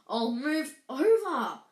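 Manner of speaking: loud, slow, exasperated snarl. Acted. Hermione to friends at the locked door, snarled. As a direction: shove them aside with the voice. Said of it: "Hermione snarled".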